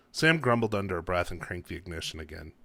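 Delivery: ordinary delivery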